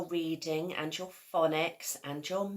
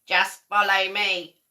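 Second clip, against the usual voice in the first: louder, lightly croaky toad; a hint of an old toad's croak; 2 semitones higher, 10 dB louder than usual. A narrator's reading. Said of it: "croaked a lumpy old toad".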